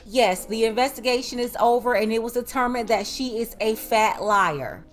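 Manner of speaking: ordinary delivery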